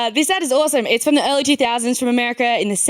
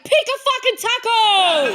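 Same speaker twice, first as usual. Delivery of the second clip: mock exasperated yell; 10 semitones higher, slower than usual. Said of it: "is like".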